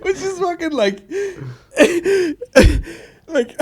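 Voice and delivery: high, wheezing, words lost in laughter; real helpless laughter. Real, not acted. Podcaster trying to explain the funny line, helpless with laughter. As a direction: try to talk while cracking up. Overcome laughing at played clip.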